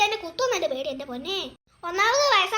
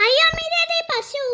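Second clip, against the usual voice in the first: excited call; 8 semitones higher than usual. Acted. Child startled, calls mother.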